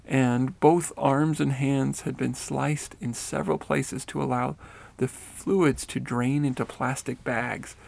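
ordinary delivery, nothing marked

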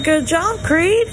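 usual speaking voice

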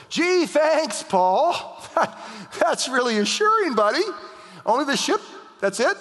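high, loud mock-grateful outburst; sarcastic disbelief. Preacher answering Paul as a doomed shipmate, sarcastic disbelief. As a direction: squeal it in exaggerated incredulity.

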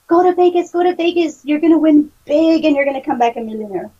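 put-on high, eager childlike voice; excited kid voice. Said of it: "the kid in me says".

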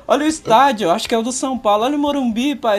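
high, bright mock tour guide; teasing cheerful. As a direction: needle a scared father with landmarks. Teasing dad with sights.

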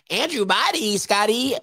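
high, sing-song voice imitating a friend; high imitation of a friend listing names; Timona's high-pitched voice. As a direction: mimic her squeaky rundown of names. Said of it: "in her high-pitched voice".

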